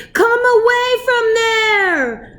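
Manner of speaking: high, slow called shout; father shouting to his children. A narrator's reading. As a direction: shout across the beach, hands cupped.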